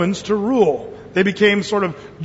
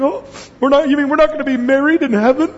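whiny upset churchgoer; 6 semitones higher, faster than usual. Pretend. Mocking an upset congregant.

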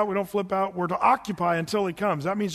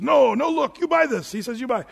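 higher and louder, insisting; pushy old shopkeeper; 6 semitones higher, 5 dB louder than usual. Retold or imitated. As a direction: push the sale on him, raised voice. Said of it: "the guy says".

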